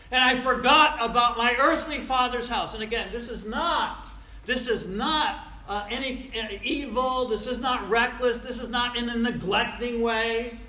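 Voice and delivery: impassioned proclamation to the congregation; forceful preaching. Real, not acted. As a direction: hammer each denial home. Preacher drives home the point.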